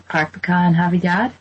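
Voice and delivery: broad Boston accent with open "ah" vowels; Bostonian accent. Shown as a demonstration. American woman shows her hometown speech, Bostonian accent. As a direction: drop the R's, Boston style. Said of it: "having a Bostonian accent".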